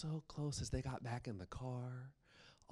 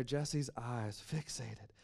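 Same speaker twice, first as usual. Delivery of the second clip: usual speaking voice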